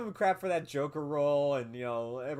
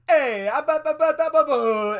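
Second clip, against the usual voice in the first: hammed-up Mario-style Italian accent, higher and louder; mock Italian accent; 11 semitones higher, 9 dB louder than usual. Mocking Jared Leto's Mario-style Italian accent to his cohosts, loud and high. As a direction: bounce the nonsense syllables like a cartoon Italian plumber. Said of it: "I wanted to hear his ... accent".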